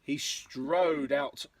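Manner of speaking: lilting, rhythmic picture-book read; story narration. A narrator's reading. Parent reading a dinosaur rhyme to a child, story narration. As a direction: read in bouncy verse rhythm.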